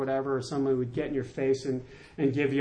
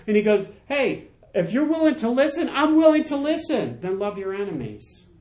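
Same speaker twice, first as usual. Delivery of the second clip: preaching at full force; 5 semitones higher than usual. Acted. Pastor pressing the congregation on forgiveness, fervent preaching. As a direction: lift the room, preach it with fire.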